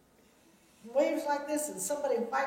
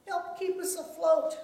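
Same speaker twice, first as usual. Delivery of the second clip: higher, louder plea for help; praying aloud; 7 semitones higher, 5 dB louder than usual. A narrator's reading. Disciples pray to stay afloat, urgent raised plea. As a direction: call it out as an urgent prayer. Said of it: "prayed aloud".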